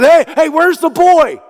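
high, loud panicked call; freaking out. Acted. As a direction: shout for the missing boy in alarm. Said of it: "He begins to freak out".

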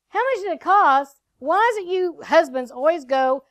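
acted-out exasperated retort; exasperated wife. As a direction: throw the question back at the husband. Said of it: "I'm like".

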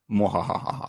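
evil villain cackle; low gloating cackle; mad scientist laugh. Acted. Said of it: "your satanic Illuminati overlord".

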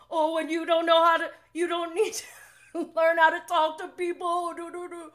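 high, loud mocking parrot voice; imitating James. Fan re-enacting James's comeback, loud high-pitched mockery. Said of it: "He's like".

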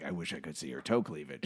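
a dreamy leering soldier, muttered under the breath; imitating Amos. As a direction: drop low and murmur the longing. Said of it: "is more like Amos from last episode".

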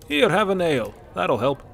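put-on hearty, loud old Nord; jovial Bracknell. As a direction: boom out a friendly offer. Bracknell offers ale jovially.

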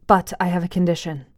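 usual speaking voice

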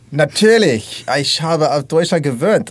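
speaking German on request; hammy German phrases, a bit slow; German accent. Pretend. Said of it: "can you please do a German accent".

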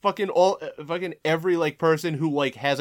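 loud, high, emphatic yeahs; exasperated rant. Real, not acted. Host confirming who loves the film, loud exasperation. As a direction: hammer it out loudly. Keeps ranting about the film's fans.

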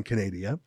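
Canada said as "kuh-NAY-dee-uh"; mock mispronunciation. Host mocking the slip, Canada said as "Canadia".

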